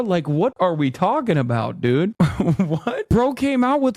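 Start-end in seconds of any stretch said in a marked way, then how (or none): none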